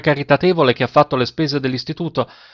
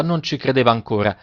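ordinary delivery

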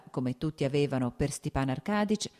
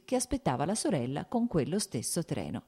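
ordinary delivery

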